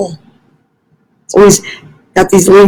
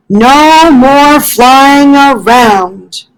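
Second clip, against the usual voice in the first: slow, higher, gently scolding little-animal voice; Fletcher gently scolding; 5 semitones higher, slower than usual. Acted. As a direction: wag a finger at the leaf, slowly. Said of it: "said Fletcher".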